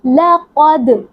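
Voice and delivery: लक़द with bouncing echo on final द; qalqala on dal. Shown as a demonstration. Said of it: "यहाँ पर कलकला होगा". (लक़द में कलकला)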